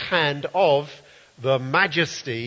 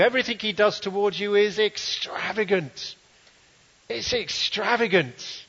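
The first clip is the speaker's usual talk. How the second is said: prophetic word; 7 semitones higher, slower than usual. Retold or imitated. Retelling a prophecy spoken over him, impassioned. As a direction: deliver God's love as a soaring prophecy. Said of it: "it went something like this".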